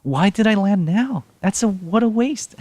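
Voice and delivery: high, slow, squealing disbelief; exasperated squeal. Real, not acted. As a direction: wail it out in high-pitched frustration. Frustrated at a wasted jump.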